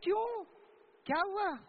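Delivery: high, startled "why, what happened?"; surprised. Real, not acted. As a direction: pitch jumps up in shock. Teacher surprised at girl's request.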